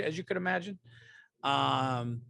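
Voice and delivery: usual speaking voice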